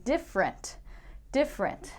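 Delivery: different said as DIF-rent; two beats, dif-rent; two-syllable different. Shown as a demonstration. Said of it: "Not different".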